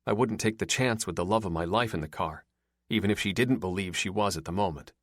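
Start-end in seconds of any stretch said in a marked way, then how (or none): none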